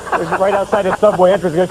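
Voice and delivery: ordinary talk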